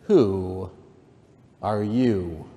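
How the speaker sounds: drawn-out "who", lightly scornful; understated disdainful caterpillar; contemptuous. A narrator's reading. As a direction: stretch "who", then pause, with mild contempt. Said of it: "said the caterpillar contemptuously".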